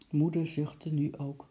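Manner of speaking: ordinary delivery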